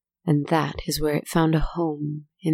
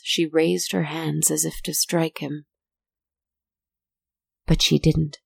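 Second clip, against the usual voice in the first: usual speaking voice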